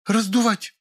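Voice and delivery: very high, tense female voice; upset young woman. A narrator's reading. Natasha accuses her colleague, upset. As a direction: throw out the word in a high pitch. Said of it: "хрипло сказала она".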